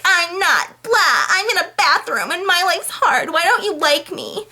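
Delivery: high, giggly drunk-girl voice, mocking her; mocking drunk Anna. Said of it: "And she's like".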